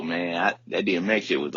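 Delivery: ordinary talk, nothing marked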